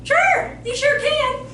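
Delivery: very high, loud, chirpy mock yes; obliging mom. Mom giving in to child in store, chirpy agreement. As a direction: play an over-agreeable mother.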